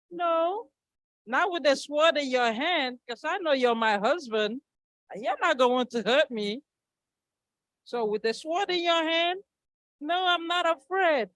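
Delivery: the wife firmly trusting her husband; confident wife. Acted. Wife answers husband's sword test.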